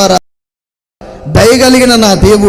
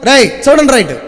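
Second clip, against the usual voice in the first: scripture reading; 3 semitones higher than usual. Acted. Pastor reading scripture to the congregation, solemn. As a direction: read the verses to the church with weight.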